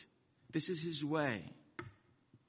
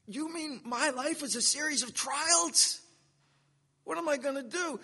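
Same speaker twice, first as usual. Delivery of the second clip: high, incredulous believer voice; dismayed believer; 9 semitones higher, 3 dB louder than usual. Acted. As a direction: play the shocked churchgoer hearing about trials. Preacher voicing a dismayed believer.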